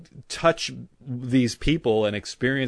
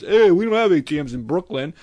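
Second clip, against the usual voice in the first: mocking Brooklyn guy; 6 semitones higher, 7 dB louder than usual. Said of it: "mocking the Brooklyn excuse".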